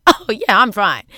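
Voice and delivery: imitated carefree "I'm fine"; laughing it off. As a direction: brush the worry off lightly. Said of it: "he's, like, laughing it off".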